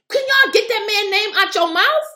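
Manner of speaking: upset. Real, not acted. Scolding Trump's critics for fixating on him, upset. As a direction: snap it at the critics, really worked up. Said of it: "I'm getting upset".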